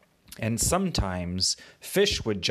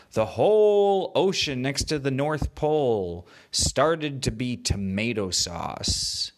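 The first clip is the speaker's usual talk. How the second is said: ordinary delivery, nothing marked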